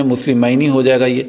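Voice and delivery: usual speaking voice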